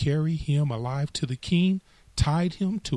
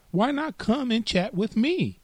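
grasshopper; 10 semitones higher than usual. Acted. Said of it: "said the grasshopper".

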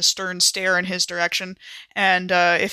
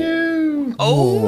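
higher, louder cry of alarm; real sudden dread; dismayed; 10 semitones higher, 4 dB louder than usual. Real, not acted. Horror at the initiative draw.

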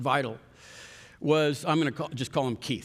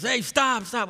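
higher, sharp mock yell; retold yell; 6 semitones higher, slower than usual. Camera guy halting the speaker mid-talk, mock yell. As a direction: imitate the camera guy stopping him short. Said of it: "the camera guy yells at me".

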